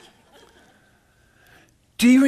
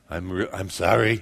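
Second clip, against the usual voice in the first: mock sincere apology; 10 semitones lower, 3 dB quieter than usual. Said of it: "Say it like you mean it".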